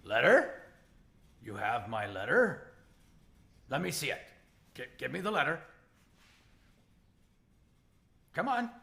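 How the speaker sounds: lightly voiced troll, words rising eagerly; troll character. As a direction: play an expectant troll, halting demands. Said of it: "the troll's head pops up".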